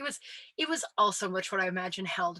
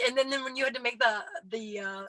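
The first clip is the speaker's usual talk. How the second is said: ordinary talk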